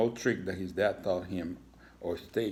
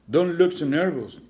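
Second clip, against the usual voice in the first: smug principal, pitched higher and louder; 9 semitones higher, 10 dB louder than usual. A narrator's reading. Principal taunts nervous Iggy, smug and loud. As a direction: tease the scared lizard a little. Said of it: "with a smug look on his face".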